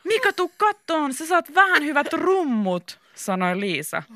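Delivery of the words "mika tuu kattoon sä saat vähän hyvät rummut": high, drawn-out call. A narrator's reading. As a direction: call a friend over, stretching words. Liisa calls Mika over. (venytetty korkea huikkaus)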